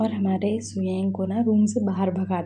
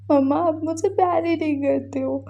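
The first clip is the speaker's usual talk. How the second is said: put-on sulky child; 7 semitones higher than usual. Child sulking to mother.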